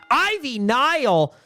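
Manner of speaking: high, stretched-out name call; wrestling announcer hype. Pretend. Announcer hyping a big match, high and slow. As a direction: draw out each word to sell the match.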